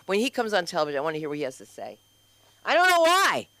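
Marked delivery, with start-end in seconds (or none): 2.6-3.5 high, loud gush; excited burst. Real, not acted. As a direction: gush it out eagerly. Playful outburst.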